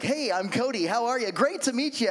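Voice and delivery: mock cheery stranger introducing himself; mock salesman greeting. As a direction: ham up a fake-friendly sales greeting. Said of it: "people that don't know you talk weird".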